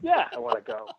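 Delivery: understated cool acceptance; mock casual. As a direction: say it like it's no big deal. Cool retake of same reply.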